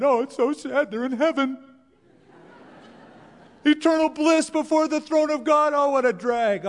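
mock the mourner's self-pity with fake sadness; mock mourning. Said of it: "I'm not crying for the person who died".

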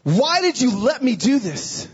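high, drawn-out anguished cry; screaming at God. Retold or imitated. Man shaking his fist at God, anguished shouting. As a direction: cry it out slowly in protest. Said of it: "screaming at God".

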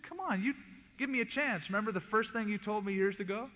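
high-pitched, coaxing appeal; retold pleading. Speaker recalls asking kibbutz leader for a chance, pleading. Acting out his plea to leader.

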